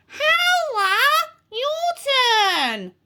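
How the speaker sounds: very high, drawn-out witchy call; summoning witch teacher. A narrator's reading. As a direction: call the pupil up with relish. Witch calls pupil up.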